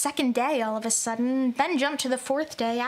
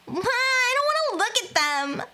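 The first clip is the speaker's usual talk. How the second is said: put-on high girl voice; 10 semitones higher than usual. Voicing frightened girl character.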